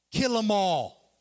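loud, drawn-out order; commanding cry. Acted. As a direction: give the command loud and slow. Elijah's command acted out.

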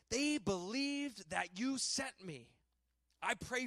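solemn reading of scripture. Acted. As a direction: read it as a fervent prayer.

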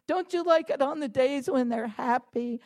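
normal talking voice

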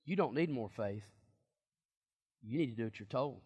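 soft, low-key delivery of Jesus' words; quietly quoting Jesus. Shown as a demonstration. Preacher quietly voicing Jesus to the disciples, soft. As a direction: let it drop, almost under your breath. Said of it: "Jesus says".

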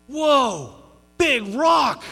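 loud, high, wide-eyed shout; excited toddler. Retold or imitated. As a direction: shout it like a little boy amazed by a huge rock. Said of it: "and he goes".

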